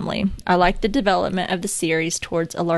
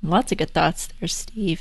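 ordinary talk

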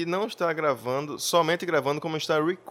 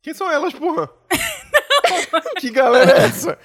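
laughing; 9 dB louder, faster than usual. Real, not acted. Confused joke about "elas.

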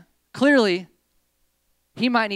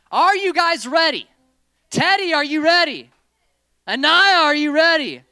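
rally the room, big and loud; loud calling out; 10 semitones higher, 10 dB louder than usual. Real, not acted. Host calling out to the volunteers, loud and high. Host checks everyone is ready.

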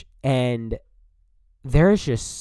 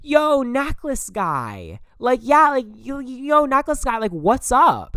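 much higher, slow dopey "yo"; mocking dude voice; 12 semitones higher, 4 dB louder, slower than usual. Imitating Jerry calling out to her tour group, mock dude. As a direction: ham up a goofy guy calling across campus. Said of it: "and he goes".

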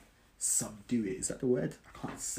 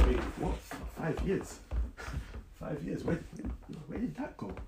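higher-pitched, incredulous questions; mock shock; 6 semitones higher than usual. Turning around to find five years gone, mock shock. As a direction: act startled, can't believe it. Imagined future self shocked at time.

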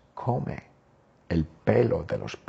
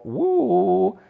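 high hooting uh of scandal; mock shocked uh; 10 semitones higher than usual. Teacher reacting to the scandal, high mock hoot. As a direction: squeal it like juicy gossip.

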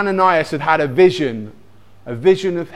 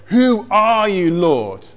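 higher, drawn-out cry on "who are you"; shouted question; 4 semitones higher, 3 dB louder, slower than usual. Acted. Blinded Saul to the voice from heaven, acted calling out. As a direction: call out to an unknown voice from the floor. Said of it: "He shouts out".

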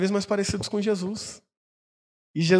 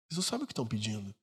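much softer and quicker, almost under the breath; soft fast repeat; 8 semitones lower, 13 dB quieter, much faster than usual. Shown as a demonstration. Pastor repeats Jesus's warning, hushed and quick. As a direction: let the line fall away in a hushed echo.

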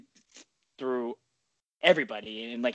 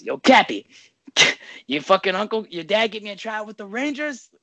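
loud, higher cocky taunt; taunting chirp; 8 semitones higher, 7 dB louder than usual. Retold or imitated. Kid chirping Cappy about his uncle, loud cocky taunt. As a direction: call him out across the ice. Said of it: "the kid goes".

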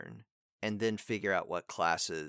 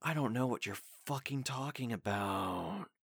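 softer take with a rise on I; softer acted line; 6 dB quieter than usual. Shown as a demonstration. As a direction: pull it back and hold the I. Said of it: "it could be like".

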